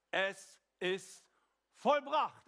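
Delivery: preacher proclaiming Jesus's cry, loud and triumphant; triumphant proclamation. Real, not acted. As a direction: cry it out as a victory shout.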